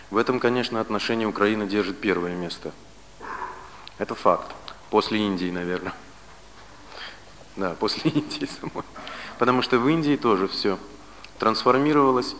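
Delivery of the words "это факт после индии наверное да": ordinary talk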